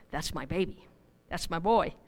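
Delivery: hushed, soft and fond; tender loving father. Retold or imitated. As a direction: whisper it like a proud father. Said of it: "that's what God said".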